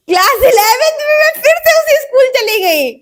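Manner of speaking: very high, loud comic wail; exclaimed reveal. Real, not acted. Telling viewers about the same school, high exclamation. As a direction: blurt it out high and loud, half laughing.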